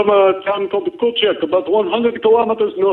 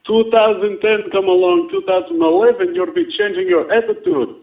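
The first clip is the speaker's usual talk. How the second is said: ordinary talk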